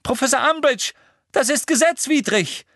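high and loud, pleading; shrill protest. A narrator's reading. Hermine protesting to Umbridge, high and loud. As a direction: cry out against her, desperate.

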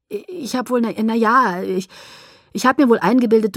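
stammering confession. Acted. Jacob admits he misread Bella's feelings, stammering. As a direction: stumble over it, then get it out in a rush. Awkward confession of mistaken love.

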